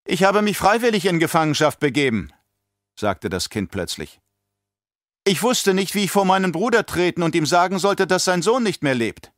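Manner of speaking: put-on a composed child speaking up unbidden; little girl. A narrator's reading. Alia tells the emperor calmly, in a girl's voice.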